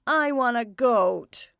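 put-on slow, loud child's wail. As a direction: wail it like a sulking boy. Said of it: "wailed Edgar".

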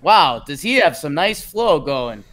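exaggerated Canadian accent slipping off course; drifting Canadian accent. Host losing track of the lineup bit, wobbly Canadian accent. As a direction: let the Canadian accent wander comically. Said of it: "What accent is this turned into?".

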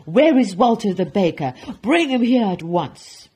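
very loud, commanding bellow; angry roar. Acted. Duke sending for the baker, angry roar. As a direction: bellow the order in fury. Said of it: "roared the Duke".

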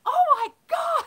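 high, loud exasperated shout; yelling. Retold or imitated. Mom yelling at her toddlers outside the store, loud high shout. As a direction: let it burst out at the kids. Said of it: "and I was yelling".

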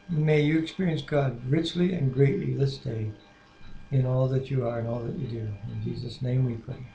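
soft, reverent spoken prayer. Acted. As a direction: lead the prayer gently and sincerely. Husband leads prayer.

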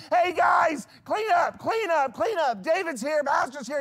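put-on high, loud, frantic calling to the kids; frantic yelling. Flustered host yelling to the kids to clean up, frantic shout. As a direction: shout the orders in a rushed panic.

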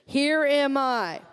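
"Here am I" drawn out slowly and earnestly; slow, reverent. Shown as a demonstration. Preacher leading the congregation, slow and reverent. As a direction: say it slowly, like a vow. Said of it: "Say that with me one more time".

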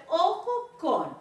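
normal talking voice

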